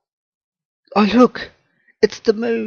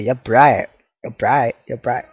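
ordinary delivery

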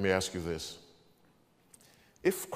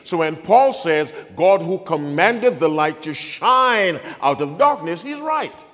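loud, high, driving sermon delivery; full-force preaching; 6 semitones higher, 12 dB louder than usual. Real, not acted. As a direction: thunder the scripture out to the congregation.

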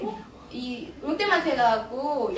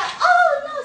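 very high, loud little bird cry; hammed-up bird squeal; storybook bird; 14 semitones higher, 10 dB louder than usual. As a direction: squeal it out in mock dismay. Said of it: "새가".

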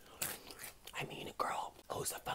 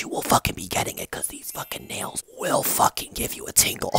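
ordinary delivery

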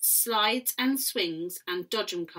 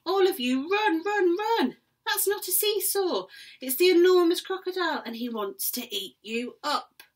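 high, loud panicked warning; frantic shout; 11 semitones higher, 7 dB louder than usual. Acted. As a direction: yell at the children to flee. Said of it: "Mugglewump shouted to the children".

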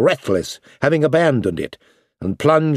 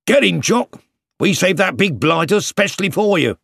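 cheering soldier; 5 semitones higher than usual. Acted. Soldiers urging dog on.

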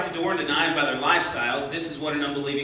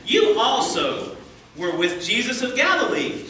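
put-on higher, louder servant girl pointing at Peter; accusing servant girl; 9 semitones higher, 6 dB louder than usual. A narrator's reading. Servant girl accusing Peter in the courtyard, higher and louder. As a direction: call him out like an accusing girl. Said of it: "she said".

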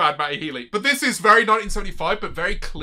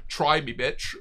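ordinary delivery

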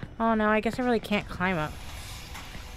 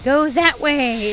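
lightly voiced game hint; 4 semitones higher, 7 dB louder, slower than usual. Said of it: "it says".

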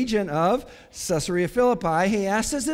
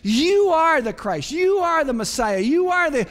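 high, loud declaration hammering "You are"; emphatic; 6 semitones higher, 4 dB louder than usual. Acted. Peter declares Jesus the Messiah, high and emphatic on "You are". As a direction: proclaim it, driving hard on each "You are". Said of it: "He says emphatically".

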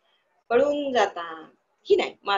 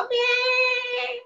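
sung-out high, slow goat bleat; much slower than usual. Shown as a demonstration. Teacher showing the goat arriving with a high sung bleat. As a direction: sing the bleat like a playful goat. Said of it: "ब्या असा आवाज करत करत आली".

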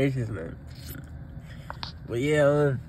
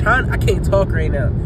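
usual speaking voice

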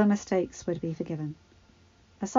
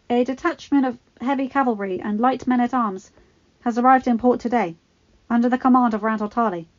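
raised, ingratiating request; oily courtier; 4 semitones higher, 7 dB louder than usual. Acted. Littlefinger interrupting the king, ingratiating. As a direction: slide in politely.

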